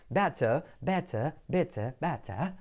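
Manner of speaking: slow, careful British vowels, crisp t; soft British demo, separating each vowel; British English, final r dropped; British accent. Shown as a demonstration. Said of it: "las vocales se diferencian así".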